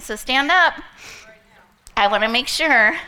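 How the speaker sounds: loud call to the room; coaching shout; rallying call. Real, not acted. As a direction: get everyone on their feet. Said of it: "coaching the audience".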